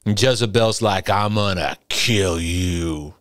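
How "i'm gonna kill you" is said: low, slow, drawn-out menacing threat; slow Jezebel threat. Retold or imitated. Preacher repeats Jezebel's threat to Elijah: slow, low menace. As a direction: drag each word down low like a villain.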